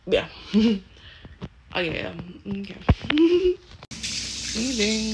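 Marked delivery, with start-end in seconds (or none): 2.8-4.5 high giggle. Real, not acted. Laughs before leaving.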